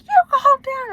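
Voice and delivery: shouted high and loud, mocking his anger; imitating his yell. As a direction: throw his angry "you calm down" back, hammed-up. Mimicking partner's angry retort.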